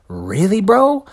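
loud, high disbelieving yell; incredulous shout. Real, not acted. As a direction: call out the hypocrisy loudly. Indignant outburst in rant.

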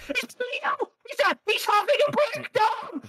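high, squealing mock alarm; mock panic. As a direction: shriek it like a frantic gossip. Players mocking him on Twitter.

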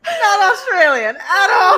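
loud, high, drawn out through laughter; laughing hard. Real, not acted. Friend admitting they fooled the booth worker, laughing hard. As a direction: crack up helplessly on every word. Cracking up at the mistaken nationality.

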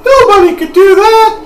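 put-on high, loud falsetto girl voice; excited girl character. Girl reacting to Dart's near miss, high loud falsetto. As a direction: exclaim it way up high.

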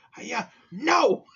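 high, loud crying shout of "no!"; mock kid's shout. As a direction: yell like an upset kid. Voicing the kid's angry outburst.

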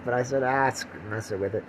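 ordinary delivery

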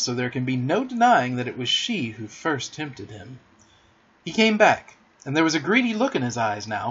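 plain reading voice

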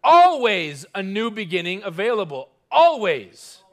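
loud, punched ALWAYS; preacher shouting God's promise. Acted. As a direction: shout it like all caps. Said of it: "He's shouting".

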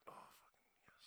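breathless whisper of victory; whispered triumph. Retold or imitated. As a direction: whisper it like a secret win. Inner thrill when it works.

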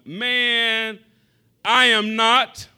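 high, loud, slow indignant denial; drawn-out "I am not" as a character; mock Peter denial. Peter snapping at the accuser, loud and slow.

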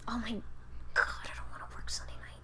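whispered groan. Real, not acted. Dreading Sunday work, whispered complaint.

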